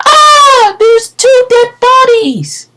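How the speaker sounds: very high, loud, drawn-out shriek; piercing acted scream of discovery. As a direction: scream it out like a horrified woman. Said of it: "all we hear is screaming".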